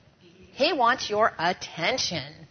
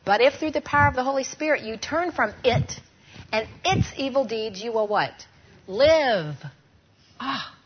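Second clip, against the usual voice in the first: scripture recited aloud; 4 dB louder than usual. Shown as a demonstration. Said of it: "Ready? maybe you can say it with me now".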